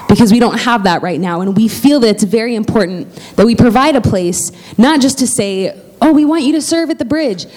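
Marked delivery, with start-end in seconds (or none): 6.0-7.5 mock invitation. Mocking a hollow church invitation.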